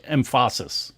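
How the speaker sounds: "emphasis" said em-FAH-sis; wrong-syllable stress. Shown as a demonstration. Host joking about wrong syllables, "emphasis" stressed on its second syllable. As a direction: stress the middle of "emphasis" as a joke. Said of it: "Emphasis on the wrong syllable".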